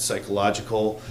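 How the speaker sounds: ordinary delivery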